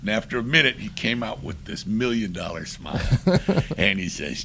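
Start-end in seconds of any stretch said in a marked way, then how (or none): none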